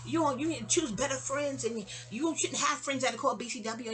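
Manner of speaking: high wailing mock yell; hammed-up outrage in a put-on high voice; [name]. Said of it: "[name]'s yelling about".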